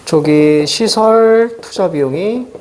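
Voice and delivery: slow, stretched words with level pitch; drawn-out dictation. Real, not acted. Teacher noting key phrase for students, drawn out. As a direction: draw each word out while noting it.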